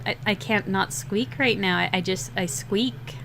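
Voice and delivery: ordinary talk, nothing marked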